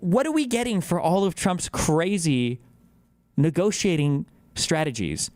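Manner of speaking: normal talking voice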